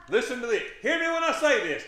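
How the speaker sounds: loud, high call to the congregation; preaching shout. Real, not acted. Preacher shouting to the congregation for attention. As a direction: belt it out to grab the room.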